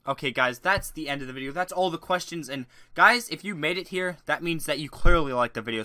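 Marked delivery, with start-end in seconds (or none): none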